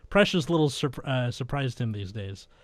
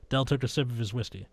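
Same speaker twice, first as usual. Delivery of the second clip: usual speaking voice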